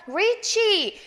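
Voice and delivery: "reachy" with a drawn-out ringing ee; wrong voiced "chee". Shown as a demonstration. Teacher showing the throat vibrating, voiced long "chee". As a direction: stretch the end of "reachy" with the voice on. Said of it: "올리죠".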